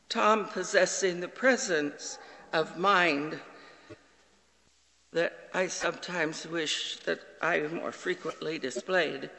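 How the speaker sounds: usual speaking voice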